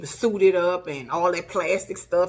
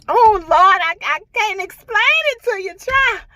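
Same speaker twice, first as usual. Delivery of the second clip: much higher, exasperated cry to the Lord; overwhelmed exclaiming; 13 semitones higher, 6 dB louder than usual. Real, not acted. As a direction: throw it up high, lost for words. Overwhelmed describing painful test.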